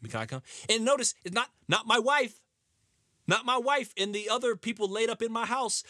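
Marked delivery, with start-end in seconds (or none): 0.1-0.4 low, softer mock begging; a lightly voiced grandpa pleading to come; whiny Grandpa Joe. Grandpa begging to move in, low and small. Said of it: "he goes".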